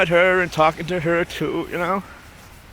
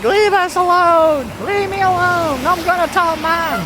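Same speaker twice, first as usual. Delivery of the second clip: put-on high-pitched whine of a chased kid; protesting little sister; 12 semitones higher, 3 dB louder than usual. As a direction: imitate a little sister threatening to tattle.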